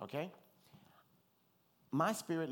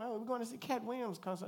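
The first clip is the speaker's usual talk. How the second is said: much higher, eager reply; lightly voiced excited friend; 11 semitones higher than usual. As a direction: tell it like big news. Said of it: "Hey, how y'all doing".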